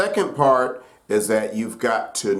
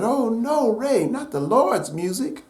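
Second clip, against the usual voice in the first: high-pitched, pleading protest; high imitation of a scandalized girlfriend; 11 semitones higher than usual. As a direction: mimic a dismayed girlfriend, voice pitched way up. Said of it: "girlfriend said".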